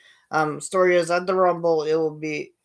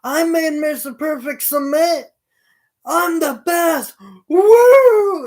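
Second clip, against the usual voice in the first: high, loud gloating that ends in a big woo; over-the-top arrogant victory yell; boastful heel brag; 11 semitones higher, 9 dB louder, slower than usual. Retold or imitated. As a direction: crow like a cocky champion showing off. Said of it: "he's saying for weeks now".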